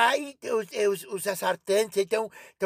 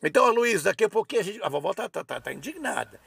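ordinary delivery

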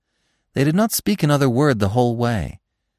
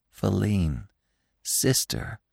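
softer and fading to a breath; 7 dB quieter, much slower than usual. A narrator's reading. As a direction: murmur it tenderly to the sister. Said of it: "said Gobo softly".

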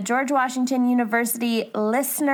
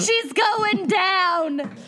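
slow, higher dramatic call; mock alarm shout; 7 semitones higher, much slower than usual. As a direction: call out a fake disaster, slowly.

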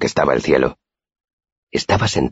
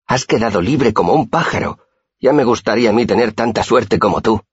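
higher-pitched, mildly exasperated scolding; curt retort; 7 semitones higher than usual. A narrator's reading. Simón reproaches his friend for his good luck, mildly exasperated. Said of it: "me espetó Simón".

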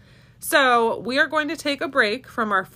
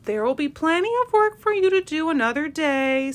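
much higher, gentle forgiving mother; kind mama rabbit; 8 semitones higher, 3 dB louder than usual. A narrator's reading. Mama rabbit forgives her kids, high and gentle. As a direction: comfort them like a loving mama bunny. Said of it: "said their mama".

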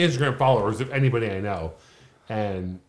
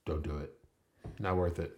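hushed warning; 7 semitones lower, 11 dB quieter than usual. Retold or imitated. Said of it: "I was like".

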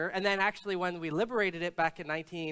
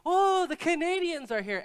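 excited crowd; 11 semitones higher, 4 dB louder than usual. Retold or imitated. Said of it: "all of these people started like".

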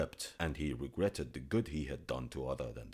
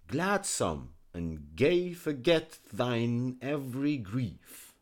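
slow, lilting verse reading; poem recital; 7 semitones higher, 6 dB louder, slower than usual. A narrator's reading. Reciting the poem to listeners, slow and lilting. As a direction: savour the old verse.

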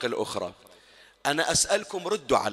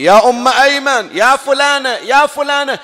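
loud, high summons across the house; calling out; 10 semitones higher, 12 dB louder than usual. Retold or imitated. The Prophet calling the women to carry food, loud high call. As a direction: shout the names to bring the women. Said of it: "صاح النبي".

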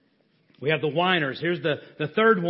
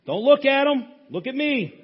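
ordinary talk, nothing marked

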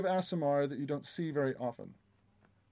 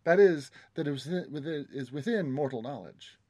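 oily flattering courtier; 5 semitones higher, 4 dB louder than usual. Acted. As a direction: sell the tour with oily charm. GM continues guide NPC.

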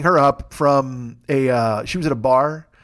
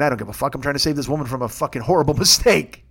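ordinary talk, nothing marked

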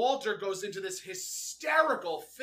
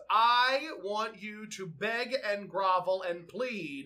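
slow, pressing each word; a hint of imperious Maud; slow demanding quote; 3 semitones higher, 4 dB louder, slower than usual. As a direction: savour the demand slowly. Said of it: "Maud says".

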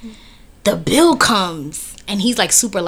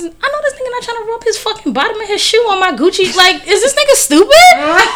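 high, outraged inner voice, louder at the end; indignant disbelief; 13 semitones higher, 4 dB louder than usual. Retold or imitated. Internal rant about his shoe.

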